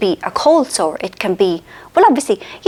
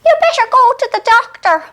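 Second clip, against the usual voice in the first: much higher, put-on voice of the mother; mother's advice; 14 semitones higher, 3 dB louder than usual. Mother tells the sick daughter to see a doctor, in a high mimicking voice. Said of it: "And she goes".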